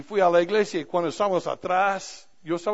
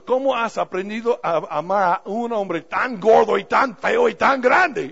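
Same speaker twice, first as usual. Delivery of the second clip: teasing shout; 4 semitones higher, 8 dB louder than usual. Retold or imitated. Teasing son-in-law loudly.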